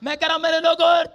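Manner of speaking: high, driving sermon delivery; full-force preaching. Acted. Preacher promising his church God's power tonight, full-force preaching. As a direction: press the promise and story on the congregation.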